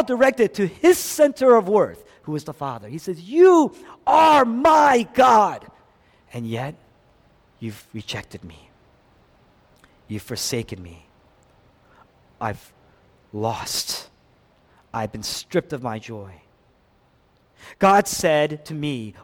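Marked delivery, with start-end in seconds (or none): 3.2-5.6 high, loud, slow cry; impassioned declaration. Acted. Jesus declaring faith to his Father, slow impassioned shout. 6.3-16.4 low, soft, halting grief; desolate lament. Acted. Jesus rejected by his Father, low desolate lament.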